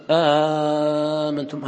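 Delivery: آمنتم with a very long opening aa; long badal. Shown as a demonstration. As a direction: draw out the aa of آمنتم very slowly. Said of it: "هذا بدل".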